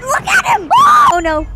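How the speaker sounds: high alarmed shout; panicked yell. Real, not acted. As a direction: yell as the monster charges. Monster charges at them.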